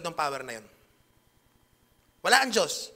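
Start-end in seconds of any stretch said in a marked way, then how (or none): 2.2-2.9 preaching emphasis. Real, not acted.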